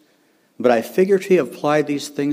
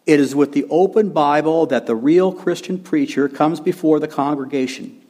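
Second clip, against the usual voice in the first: emphatic quote reading, climbing higher after the opening; Machen; 4 semitones higher than usual. Retold or imitated. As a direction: read it out with rising, forceful emphasis. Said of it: "Machen said this".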